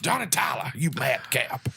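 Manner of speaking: lightly put-on Western drawl; Tombstone quote impression. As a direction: tease the gunman in a light character voice. Quoting Tombstone line.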